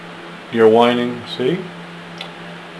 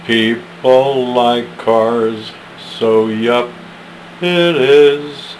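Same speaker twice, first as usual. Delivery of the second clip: slow, drawn-out recital with high questions; slower second reading; slow poem reading; 6 semitones higher, slower than usual. Acted. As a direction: linger on every word.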